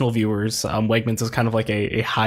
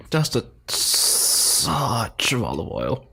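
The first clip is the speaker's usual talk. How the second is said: very slow, savoring snob voice; connoisseur voice; much slower than usual. Pretend. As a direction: drawl it like a food critic.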